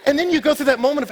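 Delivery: loud, high-pitched pulpit preaching; full-force preaching. Acted. Preacher urging church to go taste, loud preaching. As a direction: drive it home to the whole congregation. Preaches snow cone story loudly.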